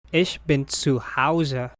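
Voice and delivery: ordinary talk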